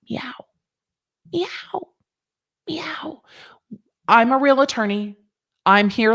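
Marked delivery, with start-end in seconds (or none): 0.1-3.2 high soft whispered meow; cat meow. Pretend. Host pandering to viewers as Lawyer Cat, soft high meows. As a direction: meow like a shy kitten. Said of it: "pander as Lawyer Cat".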